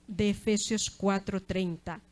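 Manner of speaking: ordinary delivery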